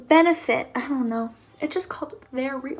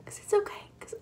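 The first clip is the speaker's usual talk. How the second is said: whispered self-reassurance; 5 semitones higher, 6 dB quieter, faster than usual. Real, not acted. Self-soothing after poking eye.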